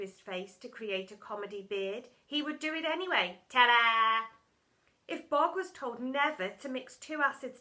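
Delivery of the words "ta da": loud triumphant flourish; showy ta-da. A narrator's reading. Bog showing off his iron beard, loud ta-da. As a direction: present it with a flourish.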